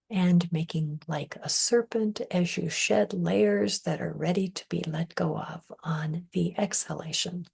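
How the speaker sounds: ordinary talk, nothing marked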